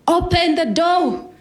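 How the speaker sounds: loud, high, drawn-out yell; retold scream. Dad demands entry at the front door, screaming. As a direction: bellow it slowly at a locked door. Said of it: "he started screaming".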